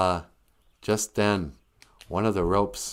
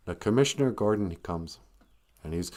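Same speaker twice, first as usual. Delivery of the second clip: ordinary talk, nothing marked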